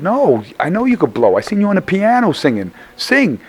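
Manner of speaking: high, rising excitement, pushing her to sing; excited urging. Retold or imitated. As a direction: coax her to blow, pitch climbing. Urging singer to belt.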